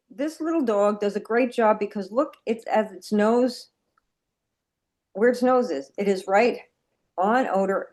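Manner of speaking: usual speaking voice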